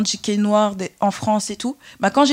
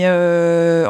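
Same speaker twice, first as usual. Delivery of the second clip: ordinary talk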